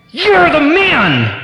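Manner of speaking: high, loud, drawn-out yell; furious accusation. Retold or imitated. Counselor suddenly points his finger at the husband, furious yell. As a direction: hurl the accusation at full force. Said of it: "breaking blood vessels in his eyes".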